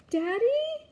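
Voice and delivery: high, loud questioning call; child's high-pitched query; worried little boy. A narrator's reading. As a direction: call out to check on squashed Dad. Boy checks on squashed father.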